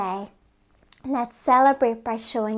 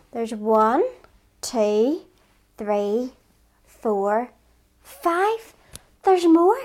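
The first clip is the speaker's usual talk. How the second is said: put-on rising counting for kids; teaching a child to count; much slower than usual. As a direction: count along, building excitement.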